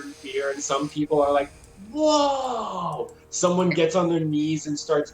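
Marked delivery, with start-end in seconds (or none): 1.9-3.1 high, falling long whoa; awed onlooker. Acted. Onlookers watching Snake Lady, high-pitched awe. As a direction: let out a drawn-out gasp of wonder.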